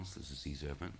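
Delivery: usual speaking voice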